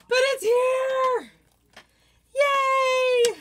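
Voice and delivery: high, loud, drawn-out celebration; excited cheer. Real, not acted. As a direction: shout it in triumph, stretching the words. Celebrating the box's arrival.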